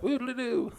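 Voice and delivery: high, soft sing-song "woo-doo-doo"; silly whooping noise. Retold or imitated. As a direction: mimic a carefree swerve in a high voice. Mimics careless driving off drunk.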